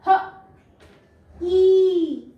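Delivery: ह, a gap, then a loud held ई; sounded-out long E. Shown as a demonstration. Teacher showing an open syllable, ह then long ई. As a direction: sound out ह and stretch ई. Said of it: "इसलिए ये long sound दे रहा है".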